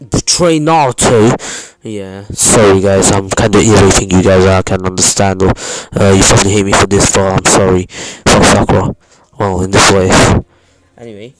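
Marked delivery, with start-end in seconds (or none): 0.1-10.1 loud blown-out mock ASMR rant; mock ASMR yelling. To the viewers, a mock ASMR rant shouted loud. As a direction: shout the apology right into the mic as fake ASMR. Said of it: "you like my A S M R".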